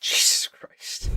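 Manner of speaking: breathy unvoiced whisper, slow. Real, not acted. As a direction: breathe it out in disbelief. Reacting to brain-microwaved joke.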